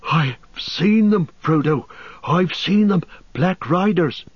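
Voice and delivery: high, gasping, out of breath; breathless fear. Acted. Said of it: "before he gasped".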